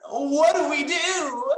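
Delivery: high, loud, tearful wail; mock sob; hammed-up crying plea. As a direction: wail the question as if in tears. Acted despair.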